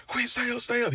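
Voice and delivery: high-pitched, panicked urging to stay awake; frantic pleading. Retold or imitated. Roommate driving to the hospital, panicked high pleading. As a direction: beg him not to fade out, voice up high. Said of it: "He's screaming frantically, like, kinda, like, in a crying way".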